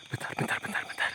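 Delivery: breathless whisper of "wait"; whispered hush. Real, not acted. Ghost hunter whispering to viewers to wait, hushed. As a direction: hush urgently, barely voiced.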